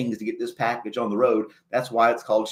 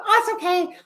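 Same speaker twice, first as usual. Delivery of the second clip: much higher, breezy mock customer voice; cheerful customer. Postal clerk imitating the happy customer, breezy and high. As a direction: sing it out unbothered. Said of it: "she was totally fine".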